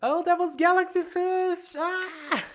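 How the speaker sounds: very high, loud squealing surprise; delighted squeal. Real, not acted. Streamer realizing a viewer was Galaxy Fish, squealing. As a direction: shriek with joy at who it was.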